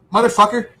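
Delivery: sudden loud angry curse, pitched high; angry outburst. Real, not acted. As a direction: spit it out in real frustration. Angry outburst about friend.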